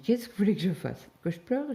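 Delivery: retold exasperated retort. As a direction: toss it back, rising high at the end. Retelling her retort to the doctor.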